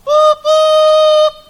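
loud high whistle imitation; whistle blast. Blows the whistle.

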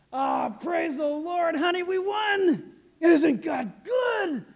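high, loud, drawn-out victory shout; jubilant shout. Acted. Preacher acts David coming home to his wife, jubilant. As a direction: holler it out in hammed-up triumph.

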